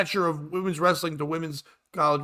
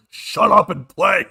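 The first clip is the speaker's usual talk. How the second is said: slow, louder mock scream; imitated dad's yell; 2 semitones higher, 6 dB louder, slower than usual. Imitating a father screaming at his daughter on court, mock yell. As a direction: stretch it out like a dad hollering courtside. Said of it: "the father just screaming on national television".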